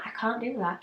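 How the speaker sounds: soft, meek refusal; meek protest. Retold or imitated. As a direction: drop the volume, protest weakly. Retells repeating the refusal.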